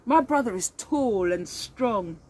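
ordinary delivery, nothing marked

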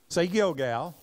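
ordinary talk, nothing marked

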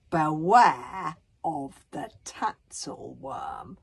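very slow, loud warning; ominous warning. Acted. Bodkin reads out the map's warning, ominous. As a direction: draw out BEWARE with dread. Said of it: "Bodkin read out the signal".

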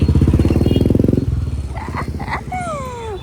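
shrill unvoiced laugh; high laugh. Real, not acted. Laughing after teasing son. (चिडवल्यानंतर उंच हसू)

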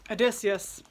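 plain reading voice

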